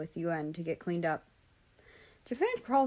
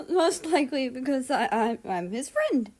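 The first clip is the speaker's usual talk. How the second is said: high embarrassed laugh then stammer; flustered laugh; 7 semitones higher, 6 dB louder than usual. Acted. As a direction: laugh it off, tripping over I. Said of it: "I blush and wave my hands frantically".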